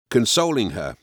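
ordinary delivery

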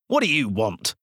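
put-on a touch of rude boy's demand. Boy Blart rudely demanding what the visitor wants, mildly rude. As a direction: snap it out rudely at the stranger. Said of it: "demanded Blart rudely".

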